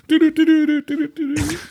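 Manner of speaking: high sing-song "do-do" ringtone; playful high-pitched ringtone imitation; mock ringtone. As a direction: trill a silly phone jingle, high-pitched. Mock ringtone made from the joke.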